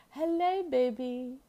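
high, loud cooing hello; pet baby talk. Acted. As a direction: coo at your baby bird. Greeting the bird.

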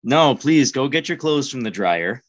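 ordinary talk, nothing marked

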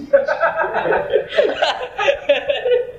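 long high-pitched laugh. Real, not acted. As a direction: burst out laughing at your joke. Laughing at own joke. (ngguyu dawa)

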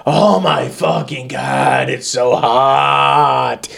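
slow, drawn-out mock moan; complaining. Overheated defendant whining in court, drawn-out mock complaining. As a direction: drag each word out in exaggerated complaint. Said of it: "just complaining the entire time".

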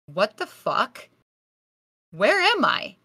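lost and spooked, voice leaping up on the question; startled confusion. Retold or imitated. Waking alone in an empty hospital, startled and confused.

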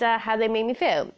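ordinary talk

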